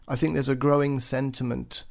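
normal talking voice

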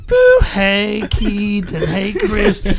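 playing back a girl cooing over the machine; girls' voicemail voice. Retelling, imitates the girls' message in a high loud voice.

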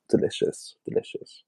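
whispered, voiceless relish; savouring whisper. Real, not acted. Savouring cheese and potato pierogi, hushed whisper. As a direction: whisper it twice, fading away.

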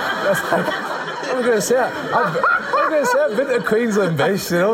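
high laughing mock boast. Real, not acted. Boasting to the interviewer, squealing with laughter. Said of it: "laughing high mock voice".

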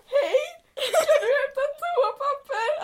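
high, slow, whiny plea broken by laughter; high mock-pitiful request while laughing; pleading brother. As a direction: imitate a desperate brother stuck on the toilet. Imitates brother pleading from toilet.

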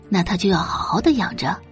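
normal talking voice

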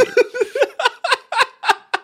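shrieking high laugh; helpless high-pitched laughter. Real, not acted. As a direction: crack up completely. Hysterical laugh at the prank reveal.

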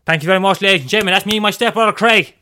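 loud showman working a crowd; street performer hype. Acted. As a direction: egg on a beatboxer over the beat. Stage act hyping up beatboxer.

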